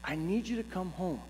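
hushed, secretive plea, imitating wife; whispering. Wife asks her husband to come home, whispering. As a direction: keep it soft, as if nobody must overhear. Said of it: "Why are you whispering?".

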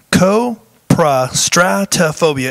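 Coprostatophobia slow and split into careful syllables. Real, not acted. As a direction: spell out Coprostatophobia by ear, one syllable at a time. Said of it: "I have to say it like that to make sure I get all of the syllables".

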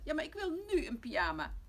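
ordinary delivery, nothing marked

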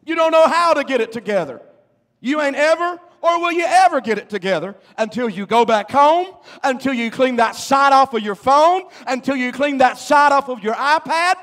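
loud, high, driving exhortation; full-force preaching. Real, not acted. Preacher orders congregation to clean up phones and homes, full-force preaching.

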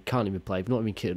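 ordinary delivery

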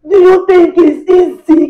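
wife breaks down at husband's excuse; tearful outburst. Acted. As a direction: wail it through tears.

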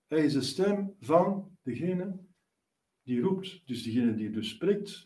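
normal talking voice

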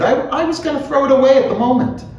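high, fast character voice; quick high-pitched protest; hurried explanation. A narrator's reading. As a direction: rattle it off high, with a small stammer. Bloom explains himself in dialogue.

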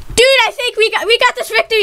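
usual speaking voice

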